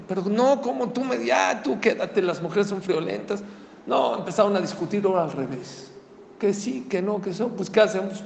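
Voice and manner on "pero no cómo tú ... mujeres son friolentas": high, protesting husband; arguing husband. Acted. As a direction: push back, insisting she keep the coat. Esposo discute, ahora al revés. (esposo protestando, voz aguda)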